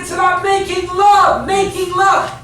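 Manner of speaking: high, insistent pulpit cry; impassioned preaching. Real, not acted. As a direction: drive the repeated phrase home, stretching the key words. Preaches at full force.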